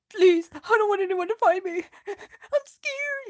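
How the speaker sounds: high, pleading mock fear; scared hobbit. Bilbo scared in cave.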